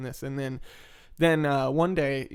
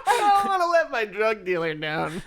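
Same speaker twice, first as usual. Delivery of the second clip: laughing; 6 semitones higher, 3 dB louder than usual. Real, not acted. Repeats line through laughter.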